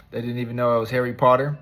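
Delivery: normal talking voice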